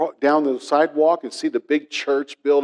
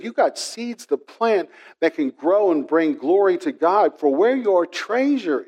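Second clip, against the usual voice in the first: higher, rising preacher's exhortation; preaching; 5 semitones higher than usual. Acted. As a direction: urge the congregation to plant seeds. Preaching climax.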